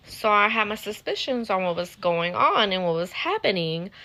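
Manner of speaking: ordinary talk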